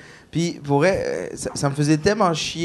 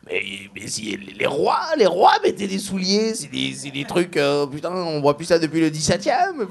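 French comedians imitated. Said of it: "Ils font".

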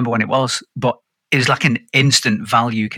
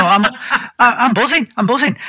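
much higher, excited and bouncy; buzzing; 11 semitones higher than usual. Real, not acted. Podcast host telling his co-hosts about his fitness goal, excited.

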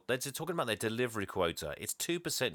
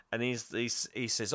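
ordinary delivery

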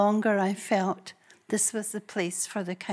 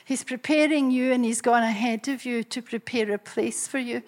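usual speaking voice

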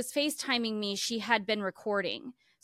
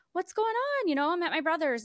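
high, startled question; suddenly alarmed, pitched way up; surprised; 9 semitones higher, faster than usual. Retold or imitated. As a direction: caught off guard on the phone. Retold surprised phone answer.

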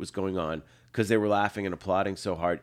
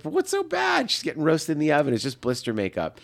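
incredulous protest; 7 semitones higher than usual. Retold or imitated. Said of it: "And I was like".

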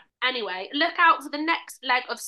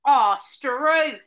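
put-on Australian accent; 2 semitones higher, 6 dB louder than usual. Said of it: "That's what people from Australia say".

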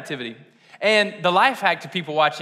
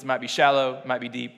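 usual speaking voice

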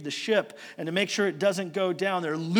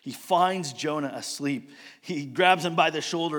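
ordinary talk

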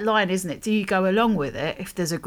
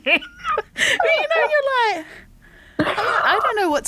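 high, laughing through the words; slower than usual. Real, not acted. Recalling the banana video to Michelle, high laughing. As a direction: giggle helplessly while telling it.

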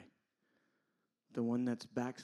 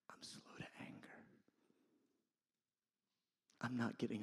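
hushed and tender, long pauses; patient reassurance; 6 dB quieter than usual. Acted. Patient God looking at a sinner, tender and unhurried. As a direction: start in a whisper, then softly reassure.

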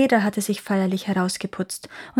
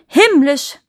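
loud, high cry of rapture; enraptured exclamation; 6 semitones higher, 9 dB louder than usual. A narrator's reading. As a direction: gush it out as a delighted chaplain. Chaplain enraptured by croaking frogs. (hoch und laut geschwärmt)

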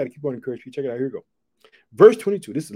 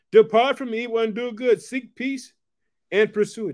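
raised, ringing scripture reading; scripture proclamation; 5 semitones higher, 4 dB louder than usual. Acted. Preacher reading the psalm to his viewers, ringing and raised. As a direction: proclaim each phrase to the congregation.

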